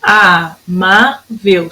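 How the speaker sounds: "Amável" split slowly into A, MA, VEL; syllable by syllable. Shown as a demonstration. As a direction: separate each syllable of "Amável" with small pauses. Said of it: "São três sílabas".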